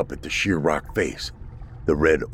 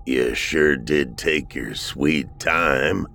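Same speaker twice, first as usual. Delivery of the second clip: put-on lower, slow unhurried old voice; calm old man; 6 semitones lower, much slower than usual. A narrator's reading. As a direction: take your time drawling it. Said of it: "Thomas said calmly".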